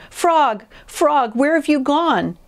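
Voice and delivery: high, repeated cries for a friend; high-pitched panicked storybook call; frantic calling. A narrator's reading. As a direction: call out high, searching in alarm. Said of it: "screamed Toad".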